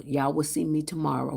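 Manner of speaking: ordinary delivery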